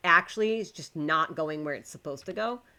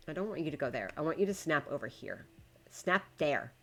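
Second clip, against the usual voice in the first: ordinary talk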